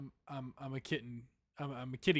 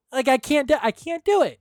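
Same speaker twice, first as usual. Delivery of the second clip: put-on much higher, louder girly voice; girl voice; 9 semitones higher, 14 dB louder than usual. Shown as a demonstration. Complaining about practising girl voice, high loud voice. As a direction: push the voice up high and bright. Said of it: "girl voice".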